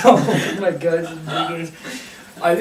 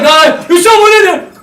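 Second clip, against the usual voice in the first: high, loud mock alarm; raised, urgent retold shout; alarmed cops; 9 semitones higher, 7 dB louder than usual. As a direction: imitate police realizing someone is trapped. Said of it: "then they're like".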